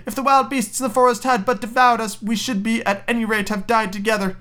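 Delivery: put-on high, lamenting girl voice; crying little girl. Gretel lamenting her fate, high pleading cry. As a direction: lament it in a high child's plea. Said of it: "she cried".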